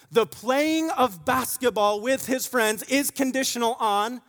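loud, high preaching with energy; full-force preaching. Acted. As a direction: drive the point home loudly.

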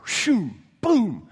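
whooshing boom imitation; fire blast sound. Pastor imitating the fire falling, whoosh and boom. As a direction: make the fire explode.

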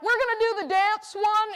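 high, fast excited announcement; chirpy voiced quote of the couple; quoting the couple. Couple announcing their dance choice, excited and high. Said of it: "I'm like".

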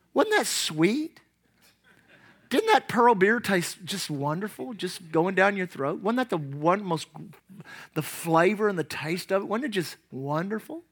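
soft, low mock savouring; tongue-in-cheek relish. Preacher teasing about first drink, soft mock relish. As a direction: coo sarcastically, as if it were delicious.

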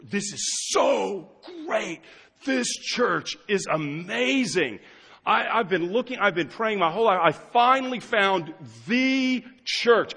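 high, slow, starry-eyed raving; gushing newcomer. Retold or imitated. Pastor mimics an overeager new member, high and gushing. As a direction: gush like someone who finally found home. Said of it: "come to me and say".

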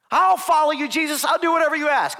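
high, loud keen volunteer; eager volunteer. Acted. Eager man promising Jesus to follow anywhere, high and loud. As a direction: leap in as the keenest recruit.

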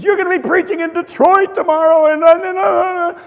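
a hammed-up frantic wife, high and stammering; panicked wife. Wife panicking over the bald spot before his sermon, high and frantic.

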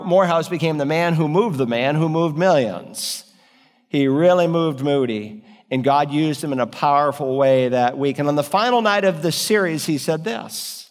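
ordinary delivery

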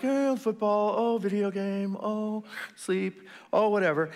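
high, drifting mock daydream; distracted mind. Preacher voicing the listeners' wandering mind, dreamy mockery. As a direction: drift from one temptation to the next. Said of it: "Your mind's been like".

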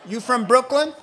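loud, high mock interrogation; challenging accent tester. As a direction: challenge the suspect for laughs. Acted checkpoint questioning of suspect.

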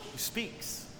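hushed whispered phrase. Acted. Preacher whispering that God speaks. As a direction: let it fall to a whisper.

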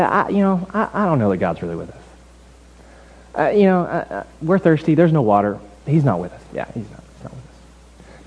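hesitant, muttered complaint dropping low; grumbling. Acted. Israelites doubting God to one another, grumbling. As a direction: grumble half to yourself, tailing away softly. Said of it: "They start to grumble".

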